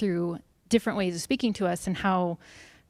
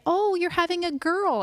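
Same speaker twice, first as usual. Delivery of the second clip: imagined doctor; 10 semitones higher than usual. Retold or imitated. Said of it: "the doctor was gonna say".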